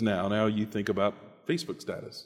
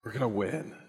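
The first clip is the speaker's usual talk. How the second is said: softer, sure inner promise; quiet confidence; 6 dB quieter than usual. Retold or imitated. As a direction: say it softly and sure. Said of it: "Peter wants us to think".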